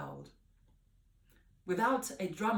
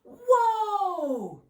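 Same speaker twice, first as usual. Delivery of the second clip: high, loud amazed whoa; gasped whoa; 13 semitones higher, 12 dB louder than usual. Acted. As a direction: gasp in wonder. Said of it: "gasped everyone".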